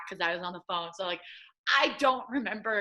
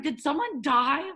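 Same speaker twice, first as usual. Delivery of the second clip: alarmed; 8 semitones higher, 5 dB louder than usual. Retold or imitated. Retelling alarm at phone blowing up.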